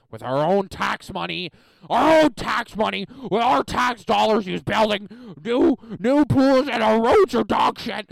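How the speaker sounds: loud, high ranting teacher voice. Retold or imitated. Retelling the social studies teacher's rant to class, loud angry rant. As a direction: mimic a teacher's furious rant, building. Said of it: "he was just going on this rant".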